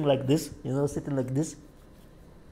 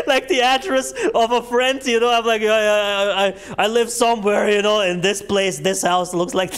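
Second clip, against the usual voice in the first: higher-pitched, on the edge of cracking up; laughing retelling; 7 semitones higher than usual. Real, not acted. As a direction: fight back laughter while explaining. Laughing at his own lie.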